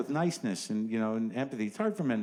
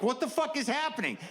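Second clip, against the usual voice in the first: mock panicked; 13 semitones higher than usual. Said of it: "I'm like".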